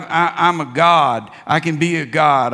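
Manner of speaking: ordinary talk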